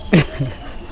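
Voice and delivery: a quiet embarrassed chuckle; soft laugh. Real, not acted. Ada laughing shyly at the interviewer's question, soft chuckle. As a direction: laugh shyly under your breath.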